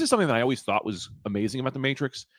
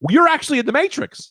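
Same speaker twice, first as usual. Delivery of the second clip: mock announcement; 12 semitones higher, 5 dB louder than usual. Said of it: "This is us telling you guys".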